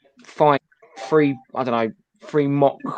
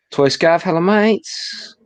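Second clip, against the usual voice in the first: mock greeting voice; 6 semitones higher, 3 dB louder, much slower than usual. Reading out a friend's message.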